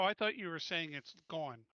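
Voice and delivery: normal talking voice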